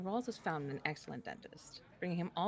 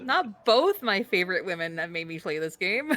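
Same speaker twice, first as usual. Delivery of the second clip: loud, laughing protest; 14 dB louder than usual. Real, not acted. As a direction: speak through the laughter. Player protests while laughing.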